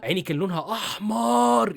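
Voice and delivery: "احمر" stretched very long and pitched high; drawn-out emphasis. Real, not acted. Telling listeners how shockingly red the eye was, drawn-out emphasis. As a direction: drag out "احمر" in exaggerated amazement.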